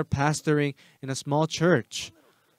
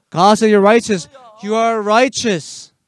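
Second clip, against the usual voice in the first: insistent retold shout; 6 semitones higher, 10 dB louder than usual. Pastor pressing the brother.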